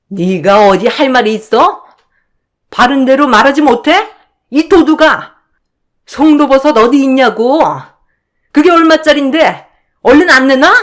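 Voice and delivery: yelling. Acted. Sister-in-law screaming at the narrator to give back the truffle. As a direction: scream at the thief to hand it over. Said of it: "제가 소리를 고래고래 지르자".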